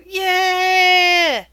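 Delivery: loud, high, drawn-out acted cheer. Human winner of the arm wrestle, long cheering whoop.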